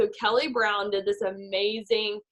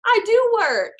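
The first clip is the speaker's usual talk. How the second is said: defensive protest; 11 semitones higher, 6 dB louder, slower than usual. Retold or imitated. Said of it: "I was like".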